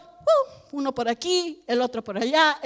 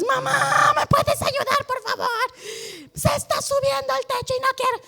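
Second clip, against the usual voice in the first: much higher, whiny plea for help; pleading child; 9 semitones higher, 3 dB quieter than usual. Retold or imitated. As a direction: play a frantic kid calling mom. Grown child begging mom for help.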